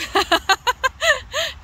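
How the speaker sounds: high, bubbling giggle; real high-pitched chuckle; amused laugh. Real, not acted. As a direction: laugh at your own teasing question. Laughs at own teaser question.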